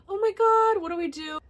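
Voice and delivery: sudden high, loud panicked squeal; panicked cry. Retold or imitated. Retelling to viewers her panic when the club had nothing, high loud panicked cry. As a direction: shriek it like the phone is gone for good.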